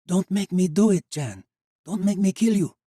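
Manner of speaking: high, loud desperate warning; a woman aiming a musket at the boy; tense warning. Acted. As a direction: plead and threaten at once.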